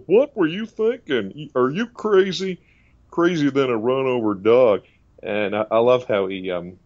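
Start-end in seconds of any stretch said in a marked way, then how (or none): 0.1-4.8 slow, high Texan scolding impression; Doctor Phil. TV therapist scolding a guest, slow Texan voice. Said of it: "did a little bit of his voice before".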